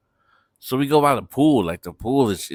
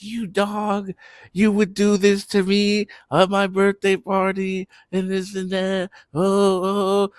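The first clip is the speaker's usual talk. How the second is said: put-on high, shrill-free accusing girl voice, pitched way up; mock angry girlfriend; 9 semitones higher than usual. Girlfriend accusing her passed-out boyfriend, high mock outrage. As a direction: wail it like a betrayed girlfriend at her party.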